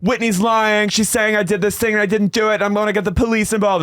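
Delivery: high-pitched accusing imitation; hammed-up indignant complaint; mock outrage. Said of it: "she storms off".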